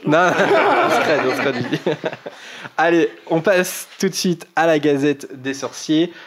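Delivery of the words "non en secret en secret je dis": laughing. Real, not acted. Joking about secret address.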